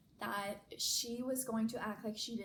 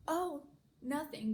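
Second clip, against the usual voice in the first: higher, drawn-out innocent brush-off; feigned nonchalance; 5 semitones higher, 4 dB louder than usual. Retold or imitated. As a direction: play it off as nothing. Casual cover story to mom.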